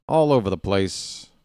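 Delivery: normal talking voice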